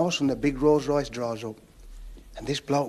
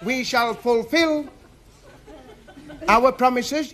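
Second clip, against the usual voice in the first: raised, halting campaign oratory; politician's speech; 8 semitones higher, slower than usual. Acted. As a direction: proclaim it to a gathered crowd in high, measured phrases. Politician promising things to a crowd.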